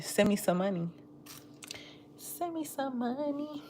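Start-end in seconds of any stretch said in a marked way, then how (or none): 2.2-3.6 high, soft, drawn-out wheedle; playful plea. Pretend. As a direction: plead softly up high, stretching it out. Playful repeat of money request.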